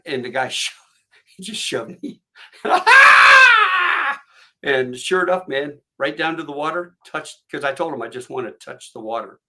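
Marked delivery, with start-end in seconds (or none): 2.4-4.2 long, very high, loud scream; falling scream. Retold or imitated. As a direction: scream as if shoved off a bungee platform. Imitates own scream when shoved off.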